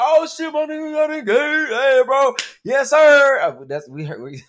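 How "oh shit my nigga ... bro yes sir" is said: loud, much higher hype-man shout; hyped guy friends. Retold or imitated. Podcaster imitates male friends hyping up the engagement, loud and high. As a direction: hype it up like the bros. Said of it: "They be like".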